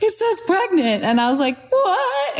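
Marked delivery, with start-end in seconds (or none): 0.0-1.0 excited disbelief. Retold or imitated. Husband reading the test result. 1.7-2.4 high, shocked squeak of disbelief; stunned what. Retold or imitated. Reacting to the pregnant test with a high, shocked squeak.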